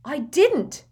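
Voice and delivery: high sharp denial. Acted. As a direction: snap the denial. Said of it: "Harry said sharply".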